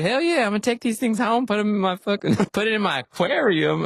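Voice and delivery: high-pitched eager buddy voice; Hubbard impression. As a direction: squint and chirp it up high, all excited. Imitating Hubbard again.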